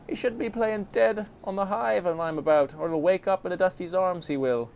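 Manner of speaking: high, defensive girl in put-on accent; feisty Anna. Anna defends herself to Farid, defiant.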